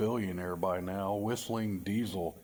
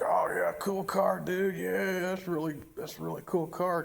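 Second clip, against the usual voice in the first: high, loud impressed dude; enthusiastic dude voice; 8 semitones higher, 3 dB louder than usual. Retold or imitated. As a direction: gush like a bro admiring a car. Quoting people praising his car.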